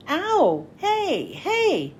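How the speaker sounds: sharp high yelps, ow then hey; yelp of pain. Acted. Hiro yelping as tape is pulled off his arm, sharp high yelps.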